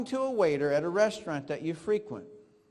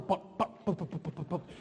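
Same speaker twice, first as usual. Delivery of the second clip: soft, breathy pop pop imitation of popcorn; playful popcorn pops; popping sounds; 5 dB quieter than usual. Shown as a demonstration. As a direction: mimic popcorn popping, quick and light. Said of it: "Look at this popcorn".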